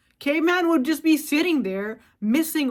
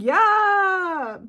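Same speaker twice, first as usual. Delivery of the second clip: high, loud mock yeah; a sarcastic little cheer; 7 semitones higher, 5 dB louder than usual. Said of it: "You'd be like".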